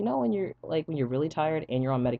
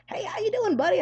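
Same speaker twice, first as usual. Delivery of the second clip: much higher bouncy greeting voice; chipper doctor. Retold or imitated. The upbeat doctor greeting his miserable patient, high and chipper. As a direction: play the doctor breezing in all cheerful.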